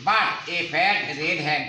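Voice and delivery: But a fat red hen, one word at a time; slow model reading for pupils. Shown as a demonstration. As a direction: drag the sentence out slowly for the children. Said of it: "teacher models the sentence slowly".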